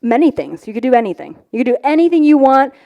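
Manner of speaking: ordinary talk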